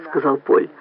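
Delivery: usual speaking voice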